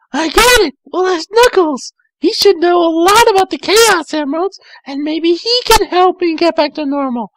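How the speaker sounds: put-on high, fast girlish voice; Tails, sweet and eager; young girl Tails. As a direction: chirp it quickly like a young fox girl. Tails has an idea.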